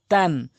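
তান with wide mouth and light t; spread light t. Shown as a demonstration. Teacher shows two letters, light spread তান. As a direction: widen the mouth on a slow তান. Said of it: "তাটা মুখ ওসার হবে".

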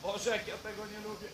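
quick soft nervous aside; muttered aside. Real, not acted. Aside before the shot.

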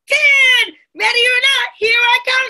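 high, loud hide-and-seek call; shouted. A narrator's reading. Amma finishing her count in hide and seek, shouting. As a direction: holler it out to hidden friends. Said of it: "she shouted".

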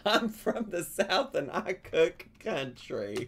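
slow, laughing Southern drawl. Retold or imitated. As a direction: read it slowly through a giggle. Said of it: "rereading it, laughing".

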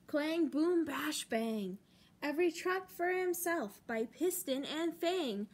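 put-on lively picture-book reading for kids; expressive children's book narration; storytime read-aloud. A narrator's reading.

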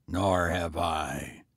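low, slow, calm tramp. A narrator's reading. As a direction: say it calmly and slow. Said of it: "the tramp said calmly".

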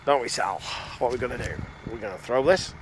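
teasing question with a swooping rise; coaxing, upswung question to a pet; playful dog talk. Real, not acted. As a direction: tease an eager dog into a game. Teasing his dog with a throw.

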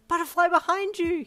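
high, loud warning scream. A narrator's reading. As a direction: scream the warning at full force. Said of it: "you screamed".

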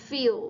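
"Feel" said like fiw. Shown as a demonstration. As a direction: model "Feel" with the final L as a w glide. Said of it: "คล้ายๆเสียง อิวอะค่ะ".

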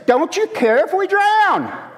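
high, loud and slow, accusing the sleeping Jesus; accusing cry. Retold or imitated. Disciples accuse Jesus in the storm, loud and high. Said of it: "they make an accusation".

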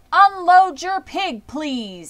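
grand loudspeaker voice; fair announcer. A narrator's reading. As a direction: boom it out to the whole fairground.

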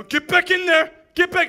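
mock shout at tears. Fake tears pushed back.

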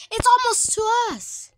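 high alarmed cry. Real, not acted. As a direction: panic rising in the voice. Fire rising toward them.

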